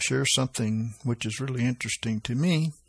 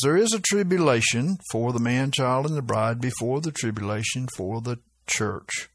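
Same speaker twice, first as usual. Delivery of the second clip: ordinary talk, nothing marked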